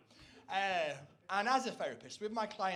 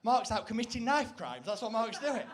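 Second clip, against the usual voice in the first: a bit higher and louder, scandalised; mock alarm; 3 semitones higher, 6 dB louder than usual. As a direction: gossip like a worried neighbour. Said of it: "it's like".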